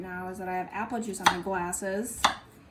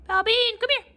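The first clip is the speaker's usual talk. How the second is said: calling out; 6 dB louder than usual. Real, not acted. Calling the dog.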